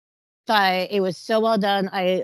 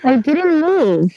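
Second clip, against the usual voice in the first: muffled; 6 semitones higher, 9 dB louder, much slower than usual. Real, not acted. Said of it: "Now you sound muffled".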